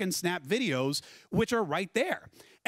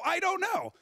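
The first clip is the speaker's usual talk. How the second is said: high-pitched, helpless acted reply; hammed-up high protest of not knowing; mock bewilderment; 12 semitones higher than usual. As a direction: pitch it way up, playing clueless. Acted quote of sheepish reply to wife.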